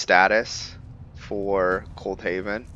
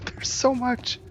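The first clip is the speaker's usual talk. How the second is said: much higher, giddy squeal after a laugh; slower than usual. Real, not acted. Gamer overwhelmed by all the loot, squealing giddily.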